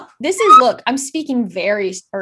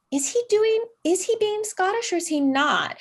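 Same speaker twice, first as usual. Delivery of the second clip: puzzled; 9 semitones higher, 3 dB quieter than usual. Retold or imitated. Quoting her own puzzled thoughts.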